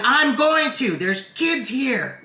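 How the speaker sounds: high, slow mock stern warning; imitating an angry father scolding a woman; mad dad. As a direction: lay down the law, word by word. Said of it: "he was mad".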